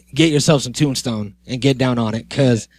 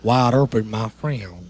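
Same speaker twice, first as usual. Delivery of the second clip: Western drawl; 4 semitones lower, 4 dB quieter, slower than usual. Acted. Repeats movie line in Western voice.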